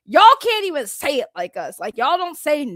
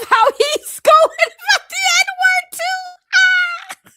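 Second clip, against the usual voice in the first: loud, high, laughing through the words; howling at the co-host's comment; laughing shout; 7 dB louder, much slower than usual. Real, not acted. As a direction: crack up and shout it out, slowed. Cracks up at co-host's remark.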